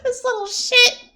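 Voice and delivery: laughing. Real, not acted. Still laughing at the character.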